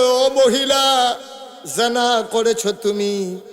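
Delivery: loud, drawn-out preacher's call. A narrator's reading. Prophet calling to the guilty woman, loud drawn-out call. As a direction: call out forcefully, stretching the address. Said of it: "নবী ডাক দেয়া কয়".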